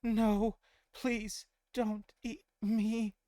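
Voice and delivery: high, slow, pleading and scared; trembling voice. Acted. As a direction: beg for your life, high and trembling. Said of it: "You can hear her trembling voice".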